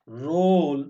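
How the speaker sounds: "রোল" loud, with a long round o; drawn-out "o" vowel. Shown as a demonstration. Teacher showing O pronounced as o, loud long vowel. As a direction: swell on the o of "রোল". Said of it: "ও উচ্চারণ হয়".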